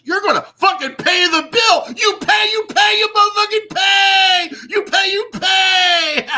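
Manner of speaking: screaming high and loud, pounding each pay; furious shout. Real, not acted. Ranting at Maximus to pay, furious high shout. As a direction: yell the threat like a furious street preacher.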